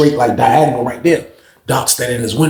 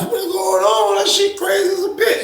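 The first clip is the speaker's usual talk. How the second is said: high, laughing inmates' reaction; laughing disbelief. Retold or imitated. Inmates reacting to the news, laughing high. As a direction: cackle through the words in disbelief. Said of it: "Everybody come out of the pod like".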